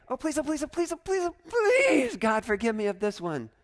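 mock begging. Preacher mocking a believer begging God, mock pleading. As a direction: beg God, pitch climbing each time. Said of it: "we don't have to beg".

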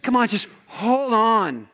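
pleading shout of encouragement; encouraging call. Retold or imitated. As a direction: call out to someone slipping. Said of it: "He's like".

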